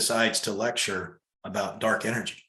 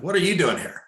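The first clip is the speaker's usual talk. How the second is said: startled challenge; 8 semitones higher, 5 dB louder than usual. Retold or imitated. Confronting a dark energy being.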